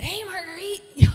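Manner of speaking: high, loud, drawn-out hail; excited greeting. Retold or imitated. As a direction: imitate Brent's big excited hello. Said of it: "Y'all know his excitement".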